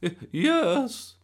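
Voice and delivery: high, loud, stammered excitement; thrilled gran; very excited. A narrator's reading. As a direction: squeal it, tripping over the word. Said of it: "never seen her so excited".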